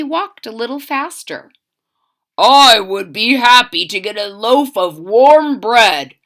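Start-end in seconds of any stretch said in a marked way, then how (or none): 2.4-6.1 a lightly voiced hopeful soldier, louder; first soldier voiced. A narrator's reading. Narrator voicing a tired soldier, a touch louder. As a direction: soldier dreaming of bread and a bed. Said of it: "said one soldier".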